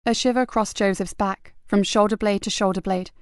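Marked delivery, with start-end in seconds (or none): none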